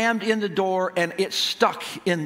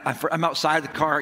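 usual speaking voice

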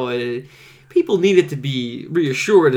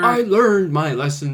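mock contrition; 5 semitones higher, slower than usual. Mimics traitor's plea to return.

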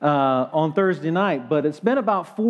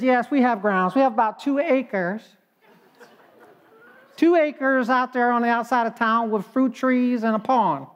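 put-on higher, cheerful older woman's voice; chirpy old lady; 4 semitones higher than usual. Older lady tells her attorney about her land, sweetly clueless.

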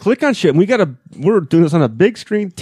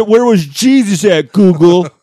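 slow, louder mock indignation; mock outraged demand; 3 semitones higher, 4 dB louder, slower than usual.

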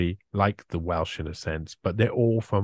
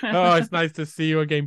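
raised mock greeting; imitating polite Brits; 6 semitones higher than usual. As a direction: greet an old neighbour in chirpy mock politeness. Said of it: "and they go".

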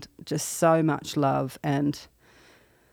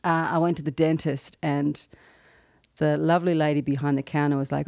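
ordinary talk, nothing marked

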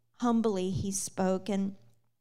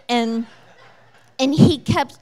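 normal talking voice

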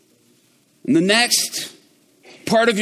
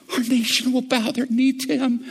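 usual speaking voice

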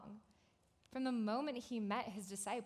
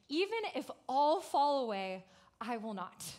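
bold declaration; 3 semitones higher, 6 dB louder than usual. Retold or imitated. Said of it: "He declared".

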